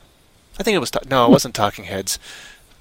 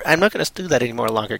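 normal talking voice

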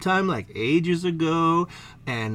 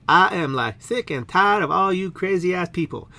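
ordinary talk

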